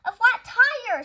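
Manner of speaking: loud, high, slow cry of dismay; dismayed exclamation. A narrator's reading. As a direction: wail at the bad luck. Dismayed at flat tire.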